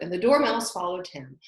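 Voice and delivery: ordinary delivery, nothing marked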